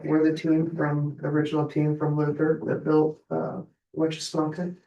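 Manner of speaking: ordinary talk, nothing marked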